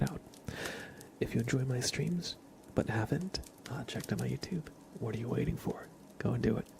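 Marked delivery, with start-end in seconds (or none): none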